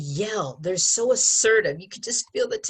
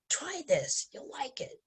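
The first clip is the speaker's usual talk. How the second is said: hushed coax; 7 semitones higher, 9 dB quieter than usual. Shown as a demonstration. Teacher prompting a student to tempt softly, hushed coax. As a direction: lure them in softly. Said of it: "you gotta be really".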